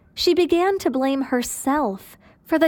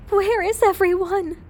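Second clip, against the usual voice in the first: high, bewildered woman's voice, pitched up; Gina's anguished question; 8 semitones higher than usual. Acted. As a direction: ask it high and lost, a grieving young widow. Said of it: "asked Daphne".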